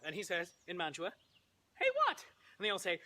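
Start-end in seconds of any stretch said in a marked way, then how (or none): 1.8-2.2 very high, comic distant reply; calling back. Acted. Romeo answers from Mantua, high comic call. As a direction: squeak it back from far away.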